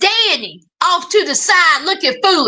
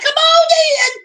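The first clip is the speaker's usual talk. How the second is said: mock welcome; 10 semitones higher than usual. Mocking careless welcoming parents.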